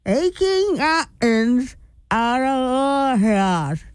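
slow repeat through a mouth guard; 'Aching mountains out of our hills' repeated slowly, lips held apart. Real, not acted. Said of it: "I'll say it again".

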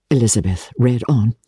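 ordinary delivery

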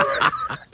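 airy unvoiced giggle; breathy laugh. Real, not acted. Laughing at his own fanboy moment, airy giggle.

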